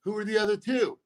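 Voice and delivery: pry for the other comics' jokes; eager comic jealousy. Retold or imitated. Comic retelling his curiosity about rival comics' jokes. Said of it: "I'm like".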